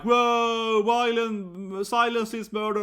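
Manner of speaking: high, loud mock outburst like a viral clip; hammed-up yell of a protester; mock scream. Imitating a student's viral-video style outburst, mock scream. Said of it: "when someone's like".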